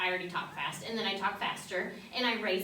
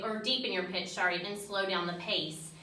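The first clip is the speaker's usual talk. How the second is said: usual speaking voice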